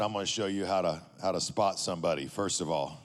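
low, deep gym-bro voice, lightly done; gruff big guy. Retold or imitated. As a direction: voice a huge, stern lifter. Huge bodybuilder's voice retold.

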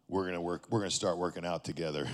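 low, deep gym-bro voice, lightly done; gruff big guy. Retold or imitated. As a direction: voice a huge, stern lifter. Huge bodybuilder's voice retold.

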